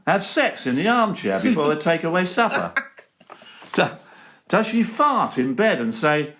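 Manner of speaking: rhythmic comic verse recital; poem recital. Acted. Comedian reciting his Queen poem, rhythmic and cheeky. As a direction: lay out each rhyme with a wink.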